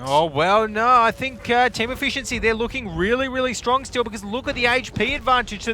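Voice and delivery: loud, high-pitched esports commentary; excited shouting. Real, not acted. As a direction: shout the analysis over the action, high and loud. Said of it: "we're shouting".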